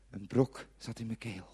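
ordinary delivery, nothing marked

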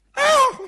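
very high, loud shriek; sudden piercing scream, acted; icy scream. A narrator's reading. Girlfriend screaming behind him at customs, icy shriek. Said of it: "Een ijzige gil".

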